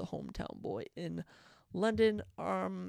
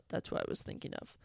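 normal talking voice

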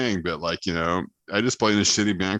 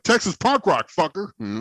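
cocky shout-out; 10 semitones higher than usual. Retold or imitated. Proudly answering a stranger's question.

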